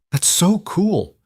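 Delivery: high, drawn-out amazement; thrilled child. A narrator's reading. Sophie hears her friend's dinosaur facts, thrilled. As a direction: stretch it out in high-pitched wonder.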